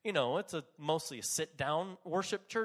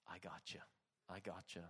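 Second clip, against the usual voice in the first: very soft, low, soothing voice; hushed, low promise of protection; tender reassurance; 9 semitones lower, 18 dB quieter than usual. Retold or imitated. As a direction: murmur it as a quiet comfort. Holy Spirit reassures in danger.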